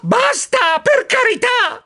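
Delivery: high, desperate squeal; squeaked plea. A narrator's reading. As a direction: squeal for it to stop. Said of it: "squittì, turandomi le orecchie". (supplica squittita, acuta)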